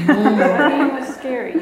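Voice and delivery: laughing. Real, not acted. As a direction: laugh out the words. Laughing repeat of scary memory.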